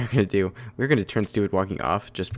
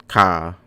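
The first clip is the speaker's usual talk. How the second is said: imitated crow caw; bird caw; 2 semitones lower, 4 dB louder than usual. Talking to Russell the pet bird, imitated caw.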